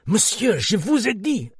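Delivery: high, firm French reply; French policeman. A narrator's reading. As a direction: insist in French. Policeman repeats refusal.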